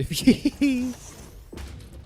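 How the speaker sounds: high-pitched giggle. Real, not acted. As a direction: burst of uncontrolled giggling. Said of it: "I can't control myself".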